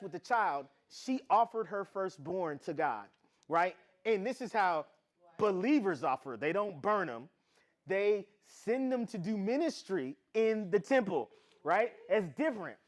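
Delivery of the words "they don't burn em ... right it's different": ordinary delivery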